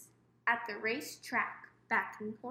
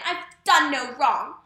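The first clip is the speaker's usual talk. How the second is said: much louder desperate protest; desperate cry; 13 dB louder than usual. A narrator's reading. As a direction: cry out your innocence. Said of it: "She cried again".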